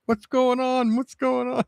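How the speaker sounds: very high, comic bewildered voice; alarmed cartoon Mars. Mars answering the yelling moon, high mock alarm. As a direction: squeak it out in mock confusion. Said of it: "Mars is like".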